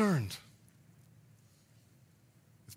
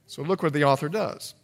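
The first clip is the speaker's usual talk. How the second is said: ordinary talk, nothing marked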